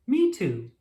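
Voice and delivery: excited agreement. Acted. Maisie agreeing with Tallulah before the walk, excited. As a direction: chime in full of excitement.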